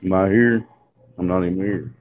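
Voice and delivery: usual speaking voice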